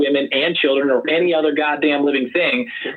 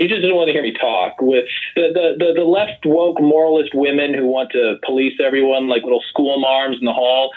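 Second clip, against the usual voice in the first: ordinary delivery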